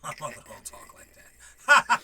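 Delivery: normal talking voice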